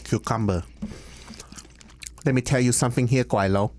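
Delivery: put-on Cantonese-English accent; light Cantonese accent, played for laughs; Americanized Chinese accent. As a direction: imitate a Hong Kong Chinese man speaking English. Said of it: "It's like an Americanized Chinese accent".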